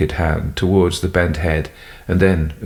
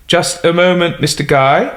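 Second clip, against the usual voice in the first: louder, higher reply; calling back; 11 semitones higher, 4 dB louder than usual. Acted. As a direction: call back politely through the door. Butler answers through door.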